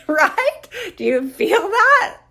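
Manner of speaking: laughing high, asking through the laugh. Real, not acted. Host laughing with listeners while asking, laughing. Laughing at own boredom joke.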